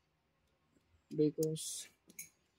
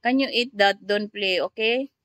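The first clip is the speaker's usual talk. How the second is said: scolding; 4 semitones higher, 8 dB louder than usual. Real, not acted. Telling child off.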